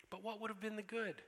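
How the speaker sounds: put-on high, anxious girlish voice; a child caught out and squirming; upset girl Lucy. Lucy protesting.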